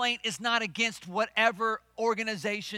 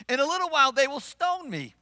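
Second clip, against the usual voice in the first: high, loud acted protest; hammed-up aggrieved complaint to God; complaining; 5 semitones higher, 6 dB louder than usual. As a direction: wail it as a put-upon leader. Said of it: "Now he's complaining".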